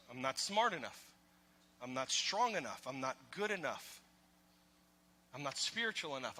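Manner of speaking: quiet, low, defeated self-talk; hushed voice of self-condemnation; inner voice of shame. Pretend. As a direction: murmur the shame voice to yourself.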